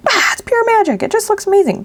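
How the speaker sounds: high, breathy gushing over a label; gushing mock awe. Crafter imitating herself marvelling at a stamped label, high gushing. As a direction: swoon over the result in a high voice. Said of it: "I'm like".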